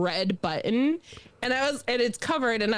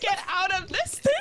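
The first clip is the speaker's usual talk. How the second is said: high, put-on lost-kid plea; mock panic; 11 semitones higher than usual. Lost child wanting off the Navy ship, mock panicked. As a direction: act out a trapped child, pitched high. Child self desperate to get out.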